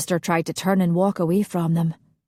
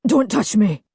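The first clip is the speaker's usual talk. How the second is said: hoarse; 3 semitones higher, 4 dB louder than usual. Acted. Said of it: "he said hoarsely".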